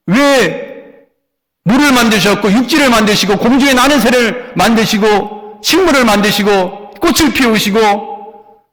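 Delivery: loud, high, driving sermon delivery; rising call-and-answer preaching; fervent preaching. Acted. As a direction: preach it out to the congregation with fire. Preaching at full force.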